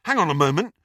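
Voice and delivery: a firm, sharp "Hang on a moment"; sharp interruption. A narrator's reading. Ron stops his friends, sharp and firm. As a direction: cut in to stop them. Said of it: "said Ron sharply".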